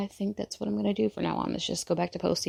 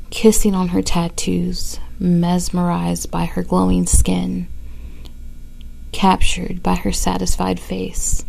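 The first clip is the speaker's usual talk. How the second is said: loud, close breathy poetry reading; poem recital; 11 dB louder than usual. Acted. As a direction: read it like a spoken-word performance.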